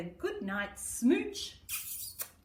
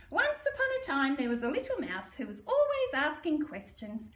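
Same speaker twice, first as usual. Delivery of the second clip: lively read-aloud opening, higher and quick at first; storybook narration; 5 semitones higher than usual. A narrator's reading. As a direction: read it like a bedtime picture book.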